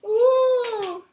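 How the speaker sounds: delighted high ooh; thrilled gasp at the smell; excited ooh. Real, not acted. Child smells the pancakes.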